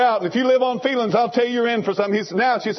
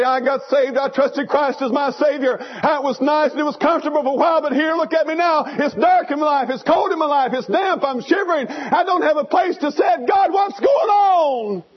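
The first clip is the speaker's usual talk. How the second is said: a hint of a cold, shivering believer, rising to a mock wail; despairing believer; 8 semitones higher than usual. Preacher voicing a struggling believer, rising to a mock wail. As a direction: cry out to God in rising despair. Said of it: "You say".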